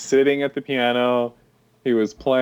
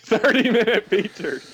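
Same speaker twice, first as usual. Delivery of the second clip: higher-pitched, amused echo; laughing; 7 semitones higher than usual. Real, not acted. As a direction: repeat it through laughter. Laughing at the absurd joke.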